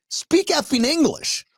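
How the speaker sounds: slow, high, emphatic demand; exasperated. Real, not acted. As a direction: draw each word out in frustration. Exasperated rant about customer service.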